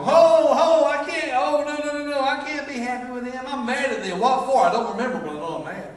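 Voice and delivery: high, loud, hammed-up protest; mock grudge-holder. Grudge-holder catching himself happy, high loud mock protest. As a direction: squeal like someone catching themselves being happy. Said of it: "you start lighting up and go".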